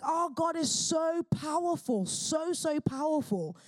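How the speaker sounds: "so, so powerful" pitched high and drawn out; awed emphasis. Real, not acted. Preacher exulting to listeners in God's power, awed emphasis. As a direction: stretch each "so" slowly, high, in awe.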